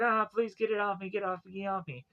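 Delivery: high-pitched pretend panic, not shouted; mock freak-out. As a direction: imitate someone pleading in mild panic. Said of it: "he starts freaking out".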